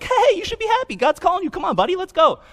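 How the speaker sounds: very high-pitched, over-friendly urging; mock cheery encouragement. Crowd suddenly coaxing the blind man, absurdly high and cheery.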